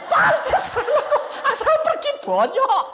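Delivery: words squeezed out through laughter; laughing. Real, not acted. Telling the congregation the punchline, laughing high. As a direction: laugh while delivering the punchline.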